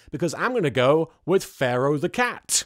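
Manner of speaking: normal talking voice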